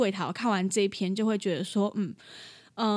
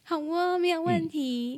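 high, sweet, overly agreeable phone voice; sweet wife phone voice; 7 semitones higher, slower than usual. Retold or imitated. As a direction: chirp it sweetly like a polite receptionist. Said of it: "我有没有觉得很像？".

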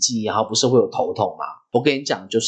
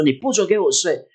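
mildly cross, higher-pitched prohibition; fierce father; 7 semitones higher than usual. Retold or imitated. Father in the car forbidding the drowsy child to sleep, mildly fierce. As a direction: imitate a stern dad forbidding it, without shouting. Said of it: "用很凶的口气跟我讲说".

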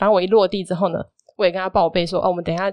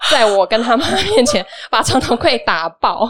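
laughing; 4 semitones higher, 4 dB louder than usual. Real, not acted. Laughing while telling the shock.

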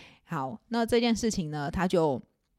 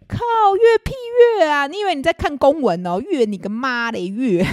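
loud furious rant, pitch shooting up; angry outburst; 7 semitones higher, 8 dB louder than usual. Real, not acted. Venting to listeners about boyfriend's curt text, furious shout. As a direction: blow up in outrage at the one-word reply.

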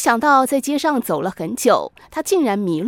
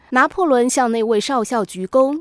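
usual speaking voice

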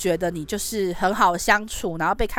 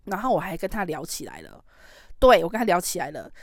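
ordinary talk

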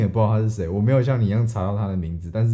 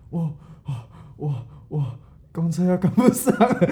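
panicked stammer; 7 semitones higher than usual. Retold or imitated. Boy stammers, out of breath.

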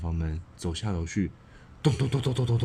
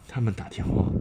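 ordinary delivery, nothing marked

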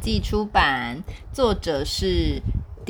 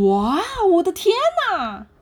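high, loud astonished gasp; amazed; 10 semitones higher, 5 dB louder than usual. Acted. Monkey amazed by the penguin family, loud and high. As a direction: gasp at the crowd. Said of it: "惊奇地说".

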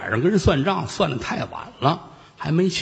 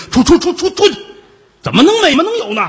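much higher and louder, stammering 出 in a rage; furious shout; 8 dB louder, faster than usual. Acted. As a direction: shout them out of the room, flustered. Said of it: "他更急了".

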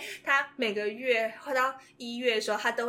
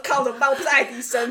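panicked laugh; 6 semitones higher, 15 dB louder than usual. Retold or imitated. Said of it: "我想说".

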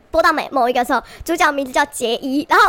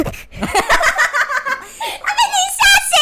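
laughing; 12 semitones higher, faster than usual. Real, not acted. Neighbour jolted awake.

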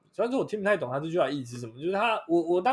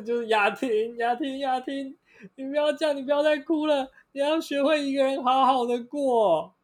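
mock tearful friend; 9 semitones higher than usual. Sister hugging Yating, melodramatic mock comfort. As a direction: comfort her like a TV drama. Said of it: "他的姐妹就跟他讲说".

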